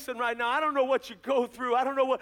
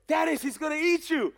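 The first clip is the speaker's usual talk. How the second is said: high-pitched mock warning yell; imitating a yelled warning; retold yell; 7 semitones higher, 4 dB louder than usual. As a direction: call it out as a teasing scare. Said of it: "I yelled".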